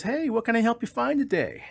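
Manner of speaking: cheery shopkeeper. Acted. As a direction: greet the customer with forced cheer. Manager greets duck.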